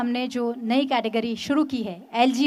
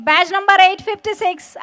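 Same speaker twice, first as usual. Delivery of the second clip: slow and louder, numbers drawn out; slow emphatic repeat; 5 semitones higher, 5 dB louder, much slower than usual. Real, not acted. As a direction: call it again slowly for the crowd. Badge number repeated for the crowd.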